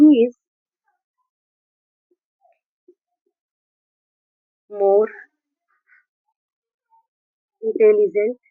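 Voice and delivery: very slow, long silences between words; halting teacher's dictation pace. Shown as a demonstration. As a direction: dictate it as if writing each word out. Dictating example while writing it.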